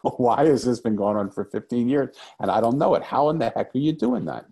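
half-laughing, stunned, demanding an answer; astonished disbelief. Retold or imitated. Father stunned that his daughter reads minds, laughing in disbelief. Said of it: "I looked at her like".

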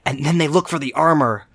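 put-on like a kid making up a story with his toys; imitating a child playing with action figures above the Sarlacc. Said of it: "Like a child holding its action, action figures and its toys above the Sarlacc and going".

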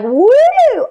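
high, loud mock whoop; excited whoop. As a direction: whoop it like a cheering crowd. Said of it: "where everybody's like".

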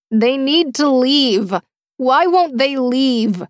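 high, slow agitated grumble; grumbling. A narrator's reading. Captor grumbles that the men outside should leave, slow agitated whine. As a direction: complain under pressure, slowly. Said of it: "the woman grumbled behind her".

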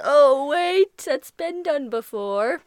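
ordinary talk, nothing marked

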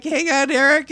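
loud, high hurried plea; flustered call. Retold or imitated. Telling boyfriend on the phone to wait, loud flustered call. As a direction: call out to him over the chaos. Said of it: "I'm like".

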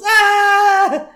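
high, loud mock scream; long freaked-out shriek, acted; Bart.

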